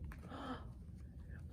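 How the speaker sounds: a whispered gasp of amazement; mock gasp. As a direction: gasp as if astonished by the picture. Teacher reacts to picture.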